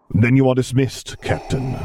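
cold, measured imperial authority; Darth Vader voice. Acted.

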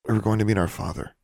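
usual speaking voice

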